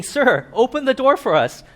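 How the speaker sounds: pleading. Retold or imitated. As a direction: beg the owner to open up. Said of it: "knocking and pleading".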